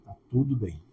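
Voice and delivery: calm, low reassurance; quiet calm reply. Retold or imitated. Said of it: "repeated exchange".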